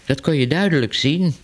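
plain reading voice

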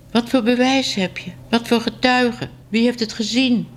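high, insistent questioning; challenging questions. A narrator's reading. Boss grills the writer for proof.

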